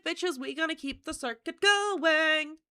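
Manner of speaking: high, loud, drawn-out rallying call; slow, loud party holler; playful hype. Pretend. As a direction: hype up the crowd, stretching the last word.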